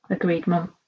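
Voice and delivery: ordinary delivery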